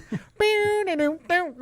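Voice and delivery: high wailing mouthed guitar lick; high sung guitar noise; vocal guitar riff. Retold or imitated. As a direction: imitate the opening guitar riff with the voice. Mimics the guitar intro riff.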